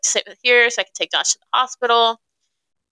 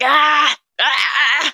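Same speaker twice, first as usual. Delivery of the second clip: imitated yell of someone hurting; imitated pain cries; 6 semitones higher than usual. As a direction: cry out as if in agony. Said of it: "in so much pain".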